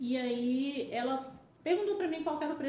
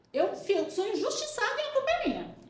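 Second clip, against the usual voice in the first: high, louder indignant whine; mock injured self; 10 semitones higher, 5 dB louder than usual. Narrator mocking her own hurt reply to her sister, high indignant whine. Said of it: "Tipo assim".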